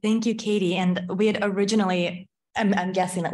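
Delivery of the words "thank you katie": usual speaking voice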